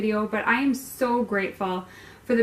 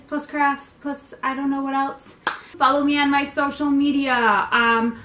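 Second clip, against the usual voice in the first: higher, louder presenter plug; broadcaster voice; 4 semitones higher, 4 dB louder than usual. Shown as a demonstration. Host promoting her social media in broadcaster voice. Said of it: "broadcaster voice".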